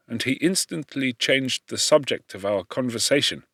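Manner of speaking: ordinary delivery, nothing marked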